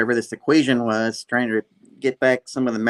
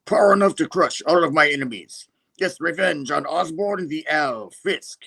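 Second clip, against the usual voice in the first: grand, higher-pitched supervillain declaration; Kingpin-like villain; 6 semitones higher than usual. Acted. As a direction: vow revenge with hammed-up menace. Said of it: "I think I'm sounding more like Kingpin".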